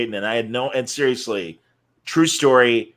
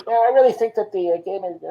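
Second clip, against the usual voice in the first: higher, muffled voice away from the handset; distant phone voice; 7 semitones higher than usual. Retold or imitated. Dying broadcaster on the phone lying down, distant and muffled. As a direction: talk as if the phone lies away from you. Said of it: "like, away from him".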